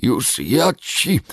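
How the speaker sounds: slow, high, loud dwarf growl; dazed dwarf threat. Acted. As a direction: threaten while still stunned. Krasnolud potrząsał kudłatą głową. (oszołomiony krasnolud grozi)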